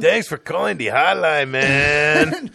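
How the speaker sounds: put-on loud Mexican-accent caricature; mock Mexican accent. Host as hotline operator, loud mock Mexican accent. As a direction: ham up a Mexican-accented greeting. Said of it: "English with a Mexican accent".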